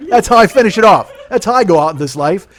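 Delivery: loud, high comic rant; ranting shout. Acted. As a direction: yell the punchline as if it's a grand finale. Ranting about his flowery suicide note.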